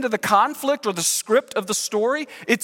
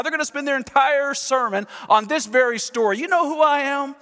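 puffed-up self-importance, higher pitched; bowing up; 4 semitones higher than usual. Pretend. Preacher imagining himself as Stephen before the council, bowing up. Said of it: "tried to kind of bow up a little bit".

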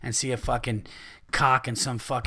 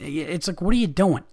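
usual speaking voice

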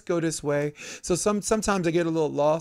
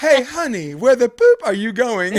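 loud, high exasperated call; mock shout; 9 semitones higher, 10 dB louder than usual. Wife shouting at husband backing out, loud high mock yell. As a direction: yell after him as he backs out. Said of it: "he backs out".

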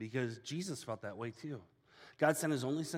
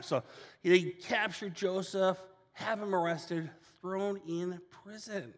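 normal talking voice

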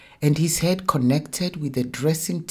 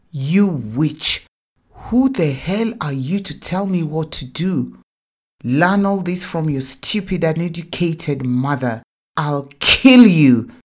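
raging, much louder, climbing to a scream; enraged father, louder and louder; furious shout; 3 semitones higher, 9 dB louder than usual. Acted. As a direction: roar at the child in fury. Abusive father screams at child.

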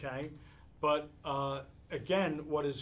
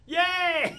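much higher, much louder cheer; delighted child cheer; 10 dB louder than usual. Retold or imitated. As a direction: squeal the child's sudden joy. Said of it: "She was like".

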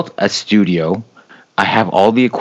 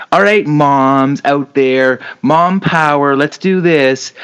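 high, slow pep-rally mom; rally the moms like a cheerleader; 5 semitones higher, slower than usual. Retold or imitated. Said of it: "those moms that are like".

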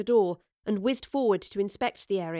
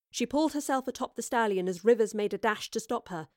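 ordinary delivery

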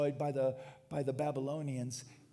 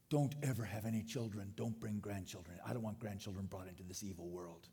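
low, subdued voice sinking softer; grave parental warning; 7 semitones lower, 6 dB quieter than usual. Acted. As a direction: play a parent quietly warning the children. Acts out a gloomy parent warning kids.

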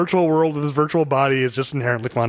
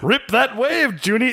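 a higher, lightly voiced grand old actor; Ricardo Montalban voice; 8 semitones higher, slower than usual. Podcaster repeating the actor's line to his co-host, lightly voiced. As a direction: toss off the old star's line with relish. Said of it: "Hearing Ricardo Montalban".

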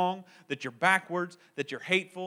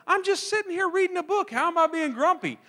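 innocent protest; 10 semitones higher than usual. Retold or imitated. Pleading innocence to family.

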